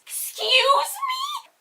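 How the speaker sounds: stunned indignation; shocked disbelief. Real, not acted. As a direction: let the outrage crack the pitch. Indignant at his rude offer.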